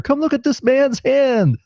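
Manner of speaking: high, drawn-out mock call; imitated girl calling out. Drive-through girl calling her coworker over, high imitated call. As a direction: summon a coworker in a high, stretched voice. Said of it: "calling some other girl that works there".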